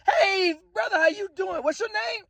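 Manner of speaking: squealing welcome; friendly woman greeting. Retold or imitated. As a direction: play an eager woman calling hello across the bar. Women greeting him warmly, retold.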